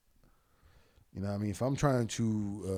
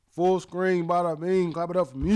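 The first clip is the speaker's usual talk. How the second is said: hyped up; 7 semitones higher, 5 dB louder than usual. Real, not acted. Hyping the clip up.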